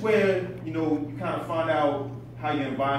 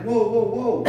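adrenaline; 4 semitones higher, 6 dB louder than usual. Retold or imitated. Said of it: "my adrenaline's pumping".

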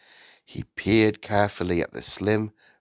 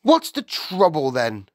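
higher, louder, irritated demand; glaring; 9 semitones higher, 6 dB louder than usual. A narrator's reading. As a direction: challenge the visitor crossly. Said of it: "glared at her".

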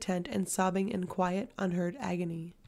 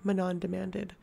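plain reading voice

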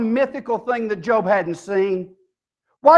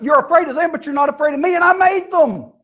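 high rebuke rising to a shout; indignant God; 8 semitones higher, faster than usual. Retold or imitated. God rebukes people afraid of animals, indignant. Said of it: "And God says".

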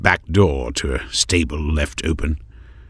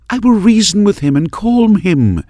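high, earnest insistence; insistent young woman; 7 dB louder than usual. A narrator's reading. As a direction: insist you can calm him. Maris insists.

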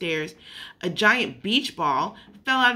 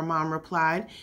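ordinary delivery

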